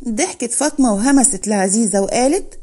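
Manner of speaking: ordinary delivery, nothing marked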